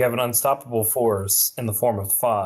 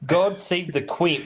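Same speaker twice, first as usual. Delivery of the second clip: higher-pitched, hammed-up patriotic cry; mock-British exclamation; 7 semitones higher than usual. Capping a string of polite British oaths, mock-British outcry. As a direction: exclaim like a caricature Brit.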